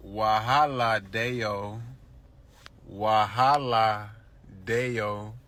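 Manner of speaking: slow, light Pidgin imitation; Nigerian Pidgin. Imitating what his girlfriend might say, slow light Pidgin. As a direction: stretch wahala out in a light Nigerian lilt. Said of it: "she could say something like".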